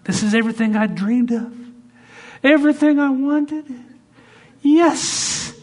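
very high, loud, ecstatic thanks; high, loud overjoyed gushing; gushing joy. Retold or imitated. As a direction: squeal with thanks like a dream came true. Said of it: "right before you grab it".

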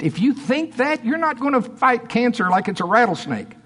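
high-pitched, urgent preaching. Real, not acted. Preacher warning church about sickness, high urgent preaching. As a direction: press the warning on the congregation.

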